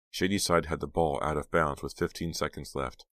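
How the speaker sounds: ordinary delivery, nothing marked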